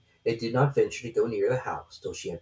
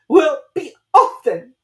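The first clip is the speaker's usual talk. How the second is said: sulky; 15 dB louder, slower than usual. A narrator's reading. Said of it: "said the pigeon in a sulky tone".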